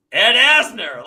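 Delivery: starstruck blurt. Retold or imitated. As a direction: blurt the name like you can't believe who's at the door. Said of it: "I don't even go, "Hey, hi, can I help you?".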